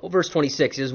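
ordinary talk

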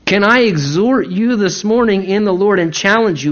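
normal talking voice

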